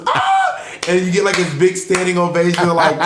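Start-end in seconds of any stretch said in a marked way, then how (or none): 0.1-0.6 high-pitched mock crowd cheer; sitcom audience scream. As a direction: shriek like a delighted studio audience. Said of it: "everyone goes".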